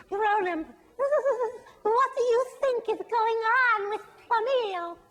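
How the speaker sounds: high, giggly fool voice; high laughing fool; silly fool. Acted. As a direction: play the giggling town fool. Fool character speaks.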